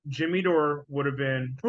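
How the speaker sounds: ordinary delivery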